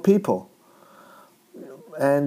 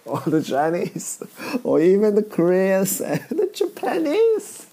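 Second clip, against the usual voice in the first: words breaking into a laugh; laughing while talking; 6 semitones higher than usual. Real, not acted. Comedian amused by blame spreading to others, laughing. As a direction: crack up as the sentence climbs higher. Cracks up at own remark.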